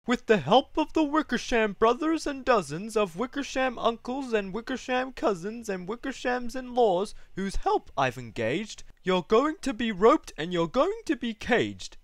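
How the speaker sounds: high, forceful threats; haughty kangaroo, cross and triumphant; scolding big kangaroo. Acted.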